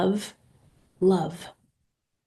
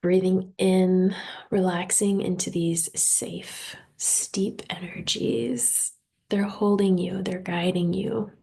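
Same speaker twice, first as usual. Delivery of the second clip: guided meditation. Real, not acted. Host leading listeners through breathing and intentions, guided meditation. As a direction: guide them unhurriedly into relaxation.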